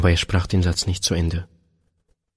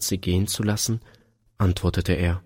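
ordinary delivery

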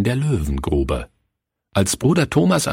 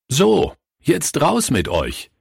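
higher, slower call to the class; lightly voiced teacher shooing pupils out; brisk teacher send-off; 8 semitones higher, slower than usual. A narrator's reading. Teacher sends boys out for break, brisk call.

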